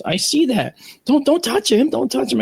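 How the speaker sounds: high-pitched, urgent mock warning; imitated flustered alarm; alarmed neighbor. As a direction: warn someone off a mangy animal, voice shooting up. Acts alarmed neighbor warning him.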